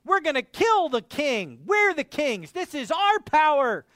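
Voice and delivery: the earth's kings defying the Lord; rebellious boasting. Retold or imitated. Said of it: "and say".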